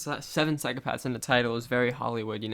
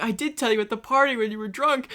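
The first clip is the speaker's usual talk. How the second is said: mock exasperated; 10 semitones higher, 8 dB louder than usual. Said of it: "He's like".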